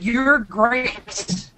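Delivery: 1 candy-heart voice. Retold or imitated. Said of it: "like Valentine candies".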